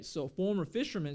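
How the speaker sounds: usual speaking voice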